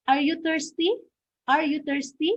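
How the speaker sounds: "Are you thirsty" pitched high, climbing on "thirsty"; rising intonation. Shown as a demonstration. Teacher modelling a yes-no question, high rise on "thirsty". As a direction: lift "thirsty" clearly upward as a question. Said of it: "Okay, so rising".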